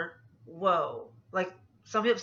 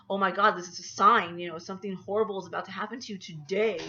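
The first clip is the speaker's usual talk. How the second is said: usual speaking voice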